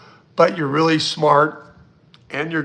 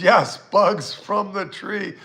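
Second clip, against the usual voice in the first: ordinary talk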